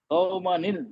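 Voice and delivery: normal talking voice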